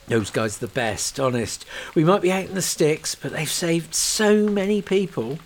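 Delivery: earnest comfort; lightly voiced officer, reassuring. Young officer reassures Kate about the firefighters, earnest. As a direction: convince her they'll be fine.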